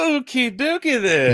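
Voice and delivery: high squeaky sing-song; mock cheery. Real, not acted. Answering a stubborn friend, high mock-cheery chirp.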